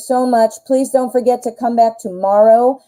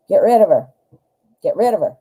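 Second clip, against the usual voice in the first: normal talking voice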